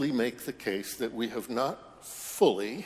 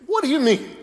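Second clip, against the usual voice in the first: very high, loud yelp of disbelief; disbelieving scream; 14 semitones higher, 12 dB louder, faster than usual. Acted. Dangling man screams in disbelief at the voice. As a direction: shriek it, unable to believe it. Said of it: "He screams in disbelief".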